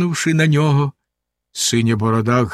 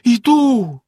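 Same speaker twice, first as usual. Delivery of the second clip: much higher, desperate cry; despairing; 10 semitones higher, 4 dB louder than usual. A narrator's reading. Wife answers Bluebeard in despair, high and desperate. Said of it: "розпачливо сказала дружина".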